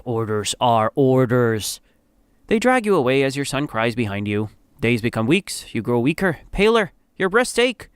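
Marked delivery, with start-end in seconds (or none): 0.0-1.8 slow, low, unyielding guard; cold. A narrator's reading. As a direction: refuse coldly, drawing it out. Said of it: "The guard coldly says".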